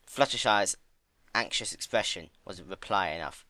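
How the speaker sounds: usual speaking voice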